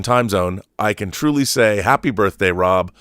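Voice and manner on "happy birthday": ordinary talk, nothing marked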